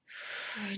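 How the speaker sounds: long, audible exhale; deep sigh out. Shown as a demonstration. Teacher shows listeners how to release a breath, sighing out. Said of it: "just sighing out".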